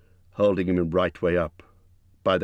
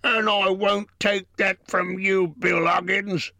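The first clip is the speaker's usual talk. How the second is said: troll quarrel; 14 semitones higher, 3 dB louder, slower than usual. A narrator's reading. Bert bristles.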